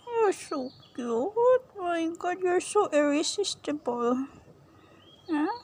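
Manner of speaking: high, slow doting coo. Real, not acted. Owner gushes over her dog, high and slow. Doting baby talk to pet.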